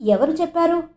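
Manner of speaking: restrained anger. A narrator's reading. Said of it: "అయినా నిగ్రహించుకుంటూ".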